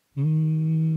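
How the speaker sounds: long steady hum; humming. Shown as a demonstration. Trainer demonstrating a drill against shrill voice, sustained hum. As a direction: hum on one note, buzzing. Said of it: "Coba ya, kita ulang ya".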